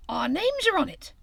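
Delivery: high, loud delighted shout; high excited shout to Grey Rabbit. A narrator's reading. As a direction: cry out thrilled. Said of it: "shouted Hare".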